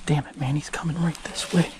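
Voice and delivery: tense hushed whisper. Real, not acted. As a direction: keep it under your breath, alarmed. Bear approaching, hiding close by.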